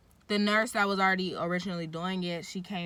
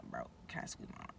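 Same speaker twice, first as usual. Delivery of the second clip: whispered, fast and lower; whispered plea; 15 dB quieter, much faster than usual. Retold or imitated. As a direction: hiss the question under your breath. Repeating her plea, whispered.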